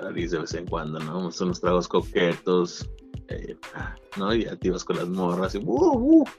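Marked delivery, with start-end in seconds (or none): 5.6-6.3 playful whoop. Retold or imitated. Excited whoop about the girls.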